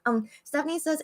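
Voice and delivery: usual speaking voice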